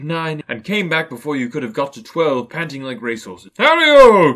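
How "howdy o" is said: big booming jolly merchant, loud and high; oily, rich, fat, jovial voice. Acted. Fezziwig calls his apprentices, loud and jovial. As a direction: boom it out like a fat, merry old boss. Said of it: "cried old Fezziwig".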